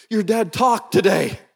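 high-pitched imitation of overjoyed, tearful mom; lightly hammed happy-tears delivery; crying mom. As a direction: announce big news in mock-tearful mother voice. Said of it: "calls me on a Saturday afternoon crying".

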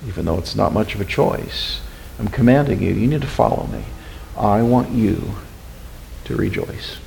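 low, hushed voice of God; quiet divine reminder. Acted. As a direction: drop soft and low, commanding gently. God gently reminding a struggling believer.

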